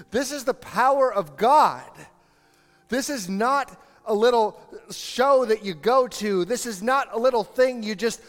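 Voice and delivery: high, loud, driving sermon; fiery preacher raising the pitch and volume; preaching at full force. Acted. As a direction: hammer home the power of God.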